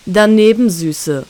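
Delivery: mock her with a smirk; villain taunting the wounded girl, mocking. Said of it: "spottet er".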